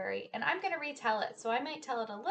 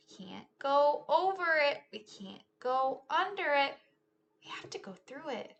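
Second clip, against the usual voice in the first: lively, sing-song storytime read; animated picture-book telling; reading to children; 3 semitones higher, 11 dB louder, slower than usual. Acted. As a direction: act out the story for a class of kids. Teacher retells picture book with moves.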